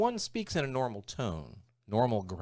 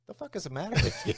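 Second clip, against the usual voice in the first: imitating a baffled friend, high-pitched; mock bafflement; 11 semitones higher, 3 dB louder than usual. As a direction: act out an incredulous reaction, pitched up. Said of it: "when they look at you and go".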